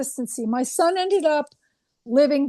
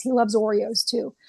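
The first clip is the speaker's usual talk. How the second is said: normal talking voice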